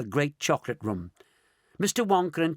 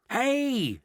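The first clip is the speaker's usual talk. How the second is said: scream; 9 semitones higher than usual. A narrator's reading. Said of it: "screamed Grandma Georgina".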